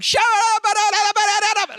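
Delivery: high, loud, rapid babble of syllables; loud praying in tongues. Retold or imitated. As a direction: imitate shouting out praise in tongues. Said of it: "ramped up from louder to praise me in tongues".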